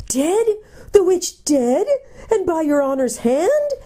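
high, rising gnome questions; astonished. A narrator's reading. Gnome hears his captor killed the witch, astonished. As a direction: marvel in a high, incredulous voice. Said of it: "in astonishment".

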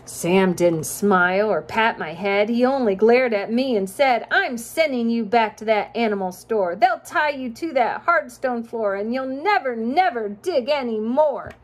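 sing-song rhyming storybook read; reading to children. A narrator's reading. Parent reading a rhyming picture book to a child, sing-song. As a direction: lilt the rhymes for a child listener.

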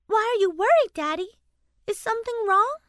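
put-on high, eager child's voice; a worried little girl asks her daddy quickly; little girl asking quickly. A narrator's reading. Daughter asking her father in bed, a quick worried child voice. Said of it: "Haley asked quickly".

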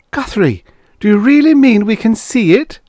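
put-on high, loud, amazed girl's question; astonished. A narrator's reading. Karen asks Guthrie about seeing the rocket, astonished. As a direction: voice soaring in disbelief.

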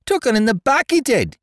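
put-on high, loud, shrill cabin boy's accusation; shrill it out like a spiteful young imp. A narrator's reading. Spiteful cabin boy accusing George to the captain, shrill and high. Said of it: "shrilled that little devil Dickon".

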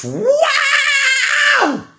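excited whoop. Real, not acted. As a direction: burst out with a whooping laugh. Excited outburst in rant.

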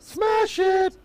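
high hype yell; hype shout. Real, not acted. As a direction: shout it like a cheerleader. Co-host hypes share button.

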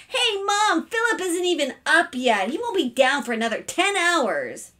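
high, loud tattling yell; yelling from another room. A narrator's reading. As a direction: tell on your brother at a yell. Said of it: "yelled from the bathroom".

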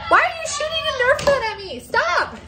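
high, fast, indignant squawk; exasperated protest. Real, not acted. Protests at being shot at.

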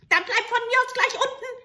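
put-on high, fast, loud screech of an old hag; spiteful witch. Witch dropping the soldier in the well, spiteful. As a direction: spit it out and let go.

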